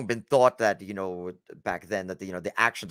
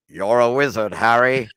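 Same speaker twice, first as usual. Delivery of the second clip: Hagrid impression; 3 semitones higher, 7 dB louder than usual. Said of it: "quoting Harry Potter line".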